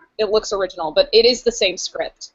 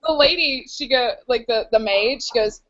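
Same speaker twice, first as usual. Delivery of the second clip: usual speaking voice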